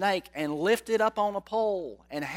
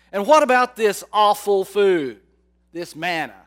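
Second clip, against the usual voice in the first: complaining; 3 semitones higher, 8 dB louder than usual. Retold or imitated. Voicing grumbling Israelites.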